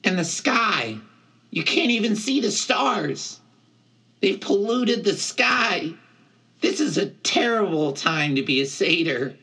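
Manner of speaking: high, aggrieved satyr voice; high, lamenting satyr; Grover, upset. A narrator's reading. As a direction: complain in a high-pitched character voice.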